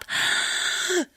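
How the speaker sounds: sharp, high hissing inhale; noisy pinched intake of breath; closed-throat gasp. Shown as a demonstration. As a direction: gasp in through a tight throat. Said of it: "I don't wanna hear a lot of".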